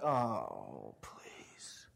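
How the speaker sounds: low groan into a whisper; whining kid. Retold or imitated. Kid dreads spring cleaning, mock groan. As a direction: sag and groan like a reluctant kid. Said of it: "You're like".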